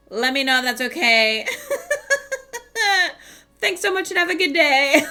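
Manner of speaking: high laughing sign-off; laughing through it. Real, not acted. Reading her own email sign-off to the listeners, laughing. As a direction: giggle out the polite sign-off.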